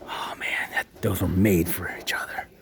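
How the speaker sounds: fast, awed whisper; hushed reverent aside. Real, not acted. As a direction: marvel at them under your breath. Whispering over the chairs.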